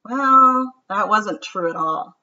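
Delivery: lively, sing-song storybook read-aloud; reading to children. A narrator's reading. Teacher reads a picture book to her class, lively and sing-song. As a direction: read it like story time for kids.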